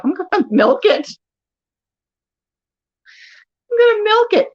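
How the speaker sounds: high-pitched, laughing glee; gleeful scheming. Real, not acted. Wife planning to exploit husband's remark, gleeful. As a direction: relish it with a giggle.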